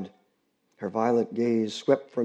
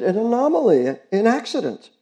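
put-on higher girlish plea, rising in volume; pleading girl; 10 semitones higher, 7 dB louder than usual. Girl begging the neighbors not to report her dog, urgent girlish plea. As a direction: beg them to spare the dog.

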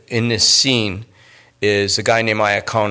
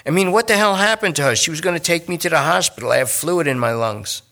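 higher, anxious pleading addict; pleading junkie; 6 semitones higher, faster than usual. Acted. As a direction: push them to check, nervous and needy. Lester worries about girlfriend.

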